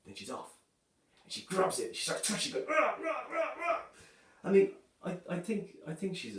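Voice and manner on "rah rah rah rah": high mock "rah rah" growl, loud; playful sleepy snarl, pitched up high; comic growl while tugging hair. Wife grabs his hair asleep.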